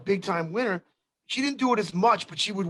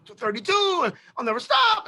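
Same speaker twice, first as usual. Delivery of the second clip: panicky protest; 11 semitones higher, 3 dB louder than usual. Retold or imitated. Friend scared of doubling bets.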